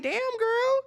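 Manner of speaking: sudden high, astonished exclamation; a shocked viewer's high-pitched aside; damn girl reaction. Retold or imitated. Narrator reacts to crying Chantel.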